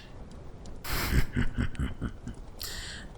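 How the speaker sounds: hushed, drawn-out laugh. Acted. Host chuckles mid-story.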